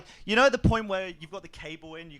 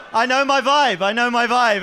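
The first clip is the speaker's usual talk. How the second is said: loud, high and laughing; cocky self-mockery; 7 semitones higher, 8 dB louder than usual. Real, not acted. Comic to heckling crowd, loud laughing swagger. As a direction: play up the crowd's jibe. Comic owns the Ryan Gosling jibe.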